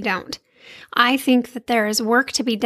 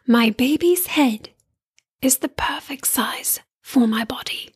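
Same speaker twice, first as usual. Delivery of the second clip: put-on calming British accent. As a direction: imitate a soothing British hypnobirthing recording. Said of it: "she had a British accent".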